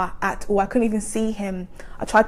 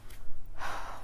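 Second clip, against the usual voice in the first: long breathy exhale; acted sigh of disbelief; heavy sigh; 8 dB quieter than usual. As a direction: let out a weary breath. Retold despairing thought in car.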